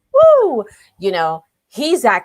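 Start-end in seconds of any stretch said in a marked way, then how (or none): 0.1-0.7 high, loud whoop; excited woo. Retold or imitated. Imitating how impressive the designer was, an excited high whoop. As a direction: let out a sudden high "woo". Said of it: "she was just like".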